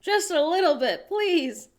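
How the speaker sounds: slow, high begging plea; pleading to leave a tip, slow and high; pleading tourist. Pretend. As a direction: beg them to take the tip. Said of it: "I'll be like".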